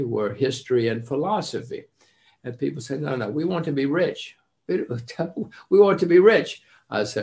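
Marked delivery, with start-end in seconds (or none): 3.0-6.6 laughing students. Retold or imitated. Students insisting on wealth.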